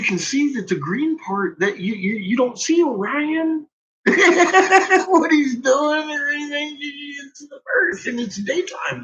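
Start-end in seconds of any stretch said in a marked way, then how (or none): 4.0-5.0 burst of laughter. Real, not acted. Laughing at his own rant.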